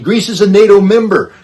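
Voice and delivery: loud, emphatic declaration, peak of the build; forceful emphasis. Real, not acted. Emphatic point on NATO disunity.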